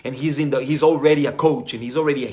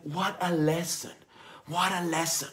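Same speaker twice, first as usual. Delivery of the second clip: softer, marvelling repeat; quiet awe; 6 dB quieter than usual. Retold or imitated. Coach softly marvelling at the lesson learned. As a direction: say it softly, amazed. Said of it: "And I'm like".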